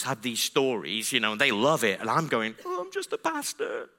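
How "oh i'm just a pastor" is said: small, high-pitched mock-timid voice; meek squeak. Pastor unlike the evangelists, meekly squeaking. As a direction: squeak it softly, playing helpless. Comic contrast with confident evangelists.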